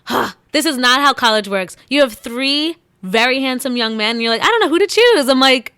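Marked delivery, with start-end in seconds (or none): 4.4-5.3 high, loud mock helplessness; mocking dilemma. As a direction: mimic a girl fussing over her choices. Mocks the character's supposed dilemma.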